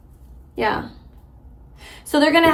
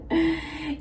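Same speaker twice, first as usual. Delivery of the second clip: soft laugh; 6 semitones higher, 7 dB quieter than usual. Real, not acted. Reader laughs at a card.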